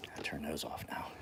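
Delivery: hushed aside to himself; whispering. Real, not acted. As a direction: whisper it as a quick note. Aside while turning lights off.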